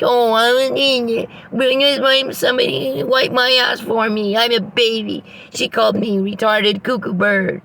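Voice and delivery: high, whiny mocking baby voice, slowed; mock whining. Mocking the man by whining high like a crybaby. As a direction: whine it back like a big baby to mock him. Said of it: "you whining and crying like".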